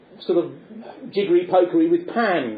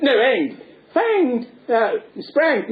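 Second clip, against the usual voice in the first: lightly voiced exasperated bobby; policeman correcting; 6 semitones higher, much slower than usual. Policeman correcting deaf Punch, mildly exasperated. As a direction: correct him, getting flustered.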